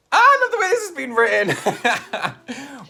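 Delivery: laughing. Real, not acted.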